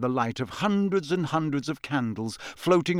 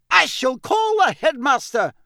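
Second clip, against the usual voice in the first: high, loud, outraged professor; furious teacher at full pitch; shouted threat; 12 semitones higher, 6 dB louder than usual. Acted. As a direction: shout a stern threat upward. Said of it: "shouted Professor McGonagall".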